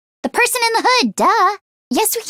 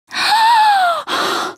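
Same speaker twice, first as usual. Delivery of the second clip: sharp gasp. Acted. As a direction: gasp in shock at what appears. Startled gasp in battle.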